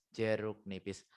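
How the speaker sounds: ordinary delivery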